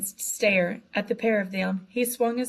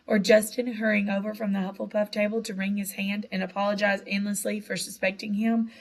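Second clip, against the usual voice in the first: ordinary delivery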